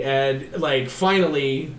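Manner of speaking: ordinary talk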